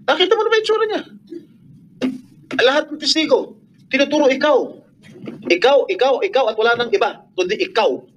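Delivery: high-pitched, indignant accusation. Real, not acted. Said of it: "Nagtataas kami ng boses dahil sin".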